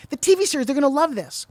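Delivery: retold eager cameraman pleading; excited insistence. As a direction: push hard like he can't let it go. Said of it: "He said".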